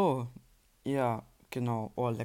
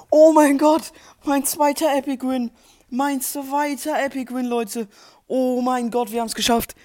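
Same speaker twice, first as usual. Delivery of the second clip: excited high yelling; shrieking jackpot winner; 10 semitones higher, 10 dB louder than usual. Real, not acted. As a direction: shout the win to the chat. Still celebrating the win.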